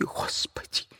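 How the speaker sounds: breathless whisper to God; whispered prayer. Acted. Katerina appealing to God, whispered. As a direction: whisper it, spent.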